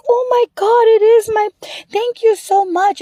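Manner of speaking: high-pitched gush of gratitude, retold; overjoyed relief. Lady gets back her precious lost picture, imitated overjoyed gasping thanks.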